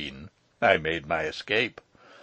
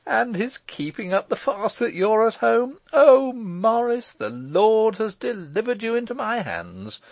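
delighted; 13 semitones higher, 7 dB louder than usual. A narrator's reading. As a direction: exult over a rival's downfall. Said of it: "cried the lawyer in delight".

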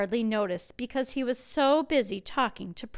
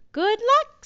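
lightly voiced king calling good luck; a hint of a cheerful king; king's farewell; 12 semitones higher, 12 dB louder than usual. A narrator's reading. King sending off his son, cheery. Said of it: "said the king".

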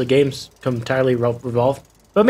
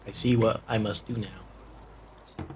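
dramatic whisper; 3 semitones lower, 9 dB quieter, much slower than usual. Pretend. Dramatic line to herself.

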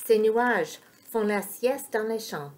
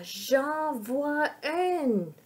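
high, drawn-out cry of discovery; excited cry; 6 semitones higher, much slower than usual. A narrator's reading. Sacha spotting a fairy, crying out slowly. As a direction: stretch every word out high in wonder. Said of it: "crie Sacha".

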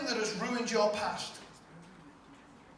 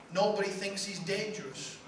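usual speaking voice

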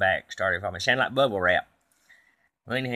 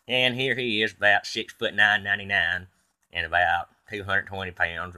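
ordinary talk, nothing marked

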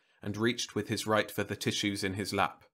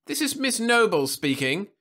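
put-on high, haughty old lady; 13 semitones higher, 7 dB louder than usual. A narrator's reading. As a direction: look down your nose at him. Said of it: "she replied haughtily".

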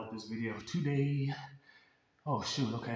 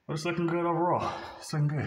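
louder, higher, pleased with the pump; 5 semitones higher, 5 dB louder than usual. Real, not acted. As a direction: hype yourself up over your arms. Flexing, pleased with his arms.

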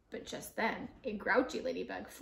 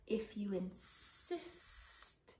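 snake voice; 11 dB quieter, much slower than usual. Acted. Snake answers.

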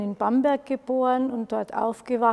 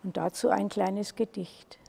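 ordinary delivery

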